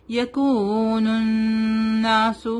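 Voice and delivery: یکون الناس with long vowels and nasal noon; slow madd. Shown as a demonstration. Teacher demonstrates the ghunnah and madd, slow. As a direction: stretch and hum the noons. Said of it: "الف مد بھی ہے".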